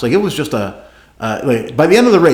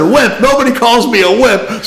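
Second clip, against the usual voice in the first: indignant; 10 semitones higher, 5 dB louder than usual. Retold or imitated. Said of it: "I said".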